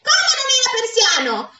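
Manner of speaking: high-pitched cry of outrage; scandalised woman yelling, voice shot way up; outraged shriek. A narrator's reading. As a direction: burst in screeching in disbelief. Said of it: "urlò in quel momento la sora Matilde".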